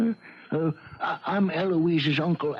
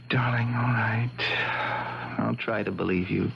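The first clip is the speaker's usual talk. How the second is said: tender relenting; 6 semitones lower than usual. Acted. Husband agrees to believe pleading wife, tenderly. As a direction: give in gently, almost under the breath.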